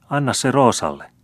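put-on high, small child's plea; little girl's voice. A narrator's reading. Little Roosa asking the old man for his chains, high childlike plea. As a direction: ask like a small girl wanting the shiny thing.

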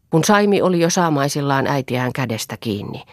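usual speaking voice

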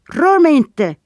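high, loud warning cry; sharp shout. A narrator's reading. Erik as Boy takes his arm, sharp shout. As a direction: shout to fend someone off. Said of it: "ropade Erik".

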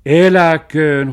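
long, very loud hurrah; shouted cheer. A narrator's reading. Dog cheers the treasure hunt, shouted. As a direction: cheer it out slowly, high and loud. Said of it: "huusi Musti".

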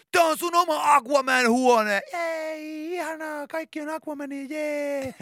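high, slowed cheery jee; gushing makeover host. Retold or imitated. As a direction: present the room like an overexcited host. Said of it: "esitellään ne kaikki hienot".

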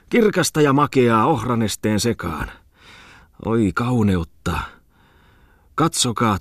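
swooning mock-poetic delivery; rhapsodizing character. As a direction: gush poetically, stretching the vowels. Tipsy host rhapsodizes about summer night.

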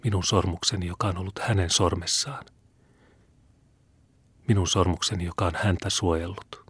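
barely holding back tears over the ring; a quiet, broken voice full of feeling; broken with emotion. A narrator's reading. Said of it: "katkonaisella äänellä ja niin suurella liikutuksella".

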